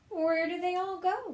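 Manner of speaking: high witchy shout at the duck; a witch yelling for her lost pigs; Gritch shouting. Acted. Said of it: "Gritch shouted to a duck".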